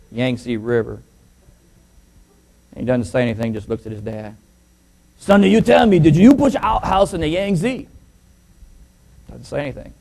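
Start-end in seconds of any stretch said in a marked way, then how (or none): 5.2-7.9 mock Chinese accent. Father pinning son against the wall, mock Chinese accent. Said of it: "this little Chinese boy".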